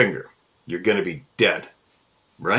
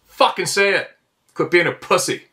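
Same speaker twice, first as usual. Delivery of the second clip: fired up; 9 semitones higher than usual. Real, not acted. Ranting at scared people.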